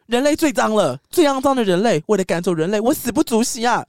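high, loud furious outburst; angry defiance. Acted. San shouting at Ashitaka that she fears no death, angry. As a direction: hurl it at him, ready to die. San yells her hatred of humans.

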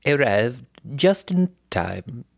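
slow, stately British royal impersonation; the Queen. Acted. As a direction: draw it out like a British monarch's address. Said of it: "The Queen greets them".

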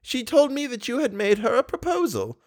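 put-on high, fond old lady voice; tender grandmotherly coaxing. A narrator's reading. Old lady calls Raoul near like a boy, high.

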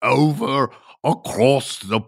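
high-pitched, drawn-out mock Scottish accent; badly faked Scottish lilt, pitched high. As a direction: do a hammed-up, terrible Scottish accent, slowly. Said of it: "That is the worst Scottish accent in the world".

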